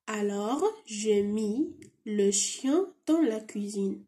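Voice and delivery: slow read-through of the dialogue; teacher rereading the dialogue for the class. Shown as a demonstration. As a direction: read the whole dialogue again slowly. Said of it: "نعد نقلكم، آه، هذا الديالوج".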